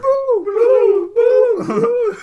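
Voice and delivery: high, loud spooky woo; ghostly wail. Pretend.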